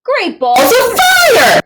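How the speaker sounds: booming high shout, very loud and slow. A narrator's reading. Father shouting in alarm at the missing book. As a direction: bellow it out in alarm. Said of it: "shouted Stella's father".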